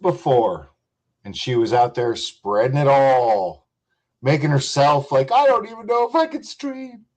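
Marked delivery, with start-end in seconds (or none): 5.3-7.1 high, put-on pitiful voice; mocking whiny quote. Mocking a streamer's fake pity in a high voice. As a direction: mimic her fake helplessness in a high voice.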